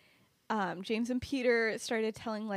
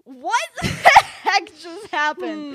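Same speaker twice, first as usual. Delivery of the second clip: high, loud, slow shout through laughter; hammed-up shock; 9 semitones higher, 14 dB louder, much slower than usual. Kyra who thought it was all fake, hammed-up shouting. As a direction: wail it in mock disbelief, cracking up.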